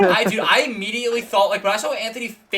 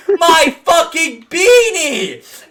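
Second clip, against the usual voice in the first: slow, high shout through laughter; imitated shout; 11 semitones higher, 11 dB louder, much slower than usual. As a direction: wail it like the beanie is gone forever. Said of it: "and goes".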